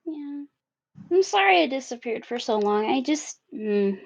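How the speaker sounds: small, subdued voice trailing off; soft hesitant apology. Acted. Acting Tally's hesitant apology.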